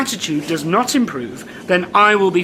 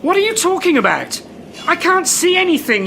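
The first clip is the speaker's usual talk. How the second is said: high, impatient protest; fed up and baffled; exasperated; 8 semitones higher than usual. Acted. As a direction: raise the pitch in irritation. Manager loses patience with the door.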